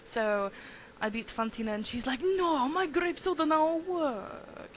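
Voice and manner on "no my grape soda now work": slow, high, loud put-on Italian accent; a failed French accent that comes out Italian; hammed-up Italian accent for the defeated gym leader; mock Italian accent. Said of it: "I just totally sounded like Italian, not French".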